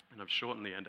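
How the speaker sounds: ordinary delivery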